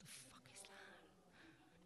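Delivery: disgusted unvoiced whisper; a shocked aside, whispered. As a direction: hiss it under your breath in disgust. Reacting to cheap Nescafe.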